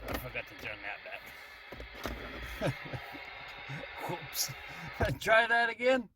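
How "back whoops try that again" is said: usual speaking voice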